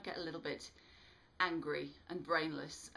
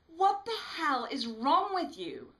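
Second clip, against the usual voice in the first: much higher, loud, slow furious shout; 9 semitones higher, 11 dB louder than usual. A narrator's reading. Bobby shouting at Smitty staggering toward them. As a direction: yell it at him in alarm. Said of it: "I shout at him".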